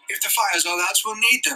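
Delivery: high urgent shout. A narrator's reading. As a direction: call it out urgently. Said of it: "He stopped shouting".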